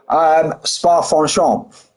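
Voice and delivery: Spa-Francorchamps drawn out slowly, French-style "fon-shomp"; showing the pronunciation. Shown as a demonstration. Streamer shows chat how to say Spa-Francorchamps, slowly. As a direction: savour each syllable of Spa-Francorchamps. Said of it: "That's how we say it".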